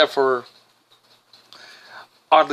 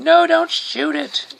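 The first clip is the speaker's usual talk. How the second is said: Wonder Woman; 7 semitones higher than usual. Retold or imitated. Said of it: "Wonder Woman's like going".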